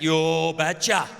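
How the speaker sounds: put-on slow, low Norwegian accent; thick Norwegian accent. Norwegian father answering a marriage request, thick slow accent. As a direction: draw it out like a stolid Norwegian. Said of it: "in his thick Norwegian accent".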